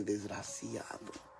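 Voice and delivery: low, slow, trailing mutter; retold muttered insult. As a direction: mutter it slowly under your breath. (insulto murmurado, lento y grave)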